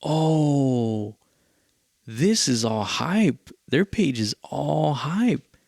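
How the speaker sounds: slow, drawn-out disillusioned realization; dawning realization. Retold or imitated. Performer realizing the show is all hype, slow retold dawning realization. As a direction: stretch it out as it sinks in. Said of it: "dawned on me".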